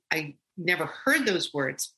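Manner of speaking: normal talking voice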